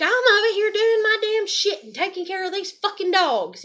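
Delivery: high, loud shout across the yard; retold shout. Shouting back to her dad while tending the dogs, loud.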